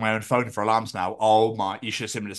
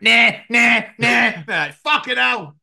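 high loud mock protest; hammed-up groggy sleeper; mock groaning; 10 semitones higher, 6 dB louder, slower than usual. Host acts out neighbours woken by alarms, groaning.

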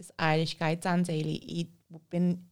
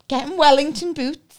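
ordinary talk

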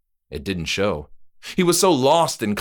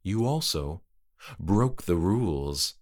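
quiet accusation; 2 semitones lower, 5 dB quieter than usual. Acted. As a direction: point it out coolly. Trick-or-treater accuses.

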